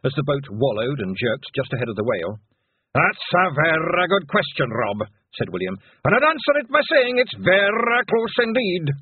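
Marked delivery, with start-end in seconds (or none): 3.0-5.1 loud, high Scots bard, drawn out; slow declaiming Scottish pixie; William the pixie. A narrator's reading. William answers in bardic Scots. 6.0-8.9 loud, high, declaiming Scots bard; William the pixie. A narrator's reading. William answers in bardic Scots.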